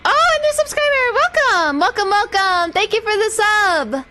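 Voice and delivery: high, bubbly welcome for a new fan; excited greeting. Real, not acted. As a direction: squeal the welcome with delight. Greeting a new subscriber on stream.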